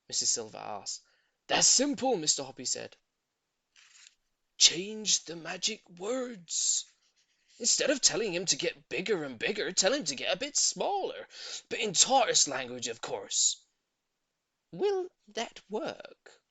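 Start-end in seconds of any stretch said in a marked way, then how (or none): 1.5-13.6 put-on louder, confident old gentleman; kindly old man. A narrator's reading. Voices Mister Hoppy. 14.7-16.4 high, slow, hopeful doubt; doubtful lady. A narrator's reading. Voices Missus Silver.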